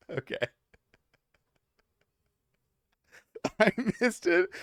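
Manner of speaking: helpless laughter between broken-off words; trying to talk through a real laughing fit; laughing to tears. Real, not acted. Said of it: "I'm gonna cry".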